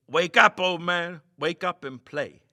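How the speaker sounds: loud call to wake a sleeper; bawling. A narrator's reading. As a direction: shout it right into his ear. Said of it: "Meagle bawled in his ear".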